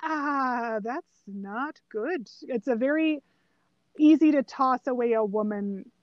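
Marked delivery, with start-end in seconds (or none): 0.0-2.3 quiet dismay. Retold or imitated. Retold uneasy reaction while watching film.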